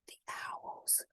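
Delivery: whispered, breathless with fear; a scared little whisper, almost no voice; frightened whisper. Acted. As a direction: hush it out as if the owls might hear. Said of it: "she cried".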